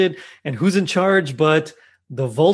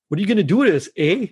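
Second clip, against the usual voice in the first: lightly put-on Canadian accent, raised pitch; imitated Canadian; 5 semitones higher, faster than usual. Host retelling a terrorist's question, imitated Canadian. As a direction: mimic a Canadian with a tagged-on "eh". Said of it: "they were Canadian".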